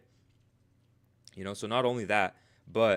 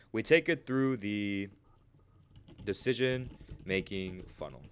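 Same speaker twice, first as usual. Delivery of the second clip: "decision-making funnel" said slow and deliberate; slow deliberate naming; slower than usual. Shown as a demonstration. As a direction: give "decision-making funnel" weight, slowly. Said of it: "let's name this something else".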